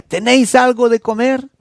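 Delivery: calling out across the water, loud and high. Acted. Stranger on the shore calls to the fishermen, loud call. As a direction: hail the boat from the shore.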